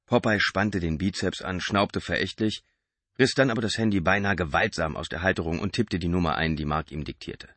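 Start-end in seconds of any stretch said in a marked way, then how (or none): none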